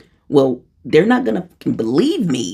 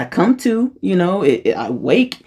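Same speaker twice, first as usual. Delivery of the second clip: ordinary talk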